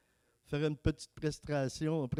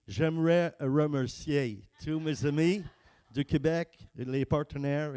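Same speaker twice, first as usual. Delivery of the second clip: put-on French spoken with an English accent, slow and louder; mock English accent on French words; 4 dB louder than usual. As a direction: play an anglophone struggling through French, English accent. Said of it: "j'ai parlé avec un accent anglais".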